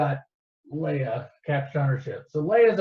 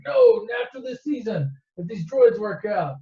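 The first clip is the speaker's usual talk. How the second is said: mock uncle voice; 8 semitones higher, 4 dB louder than usual. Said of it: "He's like".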